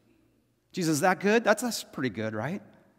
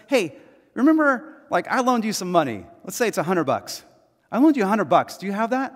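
much higher and louder, pushy demand; demanding friend; 6 semitones higher, 6 dB louder than usual. Retold or imitated. Forgiven man demands a hundred bucks, loud and high. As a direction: lean on him to pay up, voice pitched up.

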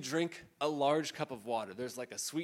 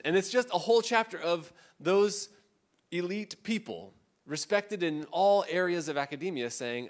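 ordinary delivery

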